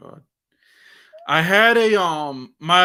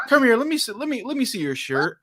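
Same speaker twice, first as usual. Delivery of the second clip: aggressive teacher; 6 semitones higher, faster than usual. Retold or imitated. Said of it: "that aggressive tone that she".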